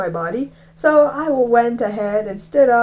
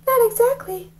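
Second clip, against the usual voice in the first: put-on high, timid boy's reply; high kid voice; 14 semitones higher than usual. Young Rafe answers the teacher in a high voice. Narrator voicing himself as child.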